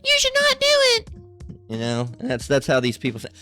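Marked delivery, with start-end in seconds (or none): 0.0-1.1 very high, squeaky mock voice; mocking protesters. Speaker ridiculing the people you hear, high mocking voice. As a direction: mimic them in a high drawn-out squeal.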